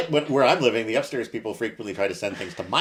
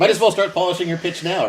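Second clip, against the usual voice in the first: laughing; 8 semitones higher, 6 dB louder than usual. Real, not acted. Teasing laugh.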